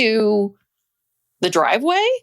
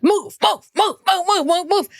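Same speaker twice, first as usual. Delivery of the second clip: high-pitched, rapid-fire repeated order; urgent mimicked command; 8 semitones higher than usual. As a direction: imitate someone urgently ordering another out of the way.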